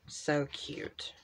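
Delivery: so cute, barely voiced, under the breath; whispered aside. Real, not acted. Crafter admiring a sticker page to herself, whispered. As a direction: hush it to yourself.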